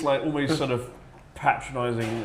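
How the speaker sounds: normal talking voice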